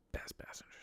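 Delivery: breathy, secretive whisper; whispered aside. Real, not acted. Driver whispering his confession about favourite passengers. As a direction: let it slip out under the breath.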